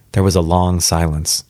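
usual speaking voice

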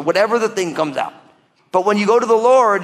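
normal talking voice

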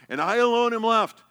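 mildly dramatize a well-practised complaint; rehearsed lament. Retold or imitated. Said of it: "like he's been rehearsing this".

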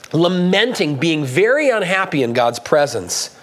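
usual speaking voice

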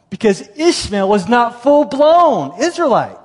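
loud, high, drawn-out proclamation; insistent objection. Acted. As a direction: hammer the point slowly and loudly. Objector's triumphant argument, voiced.

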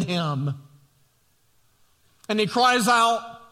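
full-force pulpit preaching; impassioned preaching. Acted. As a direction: preach it out to the congregation.

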